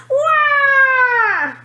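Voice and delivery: high, loud wordless wail; a spoilt girl's wailing howl; tantrum wail. A narrator's reading. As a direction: bawl like a toddler.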